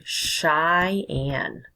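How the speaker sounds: Cheyenne split into SHY and ANN; careful pronunciation. Shown as a demonstration. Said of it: "hard to s-, probably say".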